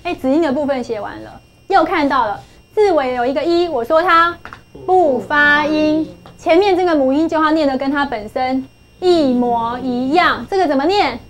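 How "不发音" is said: "不发音" stretched out very slowly, word by word; emphatic rule. Shown as a demonstration. Teacher drilling the silent final E rule, slow emphasis. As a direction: drag out each syllable of "不发音".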